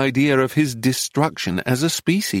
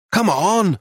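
higher, mildly raised shout; 7 semitones higher than usual. A narrator's reading. Narrator appeals to Nero for help lifting, restrained high shout. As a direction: call out urgently, pitched up. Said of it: "I shouted at Nero".

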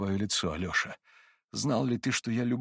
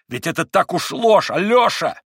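much louder and higher, flaring up; sudden shout; 8 semitones higher, 9 dB louder than usual. A narrator's reading. Ivan suddenly shouting at Alyosha, eyes flashing. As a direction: burst out with blazing fury. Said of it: "вскричал вдруг Иван, засверкав глазами".

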